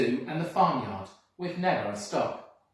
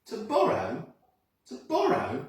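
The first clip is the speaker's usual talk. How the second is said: incredulous; 8 semitones higher, 5 dB louder than usual. Acted. Children question the thief.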